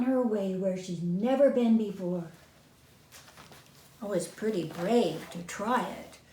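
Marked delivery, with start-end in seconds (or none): none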